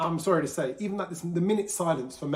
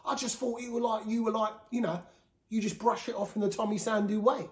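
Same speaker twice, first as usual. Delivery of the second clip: higher, lightly done Essex bloke; Essex accent; 6 semitones higher than usual. Retold or imitated. Builder friend saying he seemed fine, light Essex accent. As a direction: imitate the Essex friend, half-hearted. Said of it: "you can tell he's from Essex".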